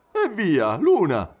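lively exclamation of disbelief; exclaiming. A narrator's reading. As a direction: scoff it out in an animated voice. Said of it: "esclamò".

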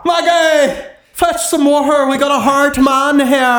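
high, hammed-up Irish accent; mock Irish accent. Host redoing the farmer's line in a hammed-up Irish accent. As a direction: overdo the movie Irish brogue. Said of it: "get [name] to just do these lines".

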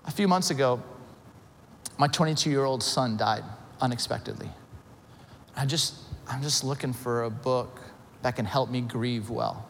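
low, soft, subdued retelling of grief; retold grieving mother. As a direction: gently voice a bereaved mother, understated. Bereaved mother explains her loss.

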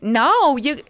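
high, loud mock telling-off; mock scolding. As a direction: scold him like a disgusted friend. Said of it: "acted scolding retold".

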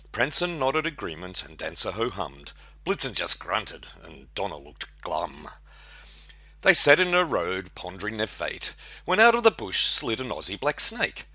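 lively rhyming read-aloud; poem recitation. Acted. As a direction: recite it like a Christmas ballad.

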